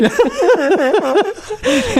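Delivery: high-pitched laughing fit; high, genuine laugh; real laughter. Real, not acted. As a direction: burst out laughing at the joke. Laughing at the Irish punchline.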